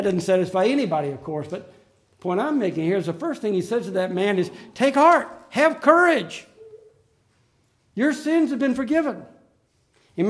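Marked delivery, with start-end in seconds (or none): 4.7-9.2 fervent. Retold or imitated. Preacher repeats Jesus's words with force.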